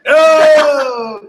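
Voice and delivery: high loud cry; teacher thrilled to find an Italian; excited oh. Real, not acted. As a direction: greet her with delight. Greeting an Italian student.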